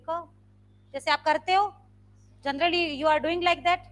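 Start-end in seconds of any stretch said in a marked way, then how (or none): 0.1-0.3 very soft, short क; nearly silent. Shown as a demonstration. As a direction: barely let the syllable out. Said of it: "बिल्कुल silently बोलोगे". (बिल्कुल धीमे, दबी आवाज़ में)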